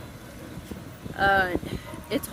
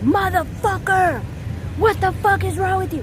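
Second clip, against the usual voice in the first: high-pitched outburst of road anger; exasperated high-pitched swearing at the wheel; driving anger; 10 semitones higher than usual. Acted. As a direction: cuss out another driver, pitch shooting up. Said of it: "call it driving anger".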